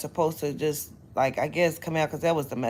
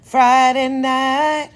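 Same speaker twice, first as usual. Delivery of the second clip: loud, slow and higher complaint; loud lament; 6 semitones higher, 9 dB louder, much slower than usual. Real, not acted. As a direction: wail it out slowly.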